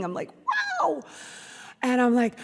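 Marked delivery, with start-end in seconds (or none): 0.5-1.0 high-pitched awed "wow"; childlike wonder. Retold or imitated. Daughter boarding the plane with her mom, childlike wonder. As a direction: marvel like an excited child. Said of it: "it's still exciting".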